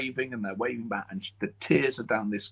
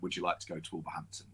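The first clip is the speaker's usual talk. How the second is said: whisper; 6 semitones lower, 10 dB quieter, faster than usual. Retold or imitated. Retold phone call offering job.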